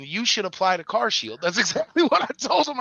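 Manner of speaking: high-pitched, laughing through the words. Real, not acted. Retelling his jab at the pest control salesman, laughing. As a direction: crack up while retelling it.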